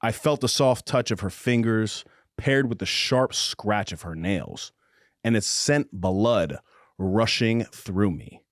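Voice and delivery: slow, low romance narration; audiobook narration. Acted. Host reading a spicy romance passage aloud, measured narration. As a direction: read it like a slow, intimate audiobook.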